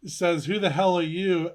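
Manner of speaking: ordinary talk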